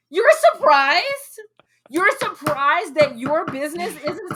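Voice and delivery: high, loud, fired-up rant with slow punched words; annoyed rant. Real, not acted. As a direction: vent real irritation, pitch climbing. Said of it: "I just get annoyed by that".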